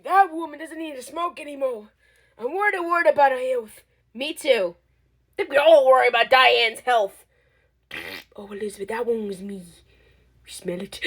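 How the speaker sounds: usual speaking voice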